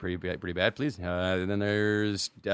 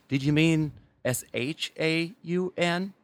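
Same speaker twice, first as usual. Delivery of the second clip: search engine voice; 5 semitones higher, slower than usual. Retold or imitated. Google correcting his name, slow higher smug machine voice. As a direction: spell it out like a smug computer. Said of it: "it used to say".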